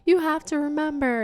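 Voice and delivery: high, desperate begging, not angry; frustrated pleading. Retold or imitated. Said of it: "still not being angry, but he's just frustrated".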